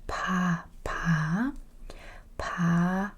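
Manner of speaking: each "pa" with a strongly aspirated P; PA with air. Shown as a demonstration. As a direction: release lots of air on every "pa". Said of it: "It's a P with a lot of air".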